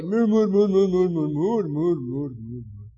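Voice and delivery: "Mur, mur" grumbling chant, falling and fading at the end; murmuring sound. Shown as a demonstration. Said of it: "Así la gente murmurando". ("mur, mur, mur" refunfuñado, cayendo hasta apagarse)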